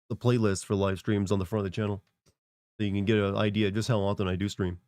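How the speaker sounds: normal talking voice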